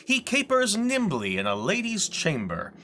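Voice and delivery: Shakespearean monologue, rising to a loud, high, ringing peak; theatrical declamation. Acted. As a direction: proclaim it to the back row of the theater, building in triumph. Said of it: "I'm going to do a reading".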